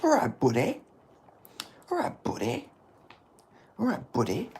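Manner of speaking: swooping, high-pitched put-on voice; mimicked catchphrase. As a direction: try the catchphrase with a jumping pitch. Trying out an impression repeatedly.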